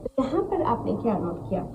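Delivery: ordinary talk, nothing marked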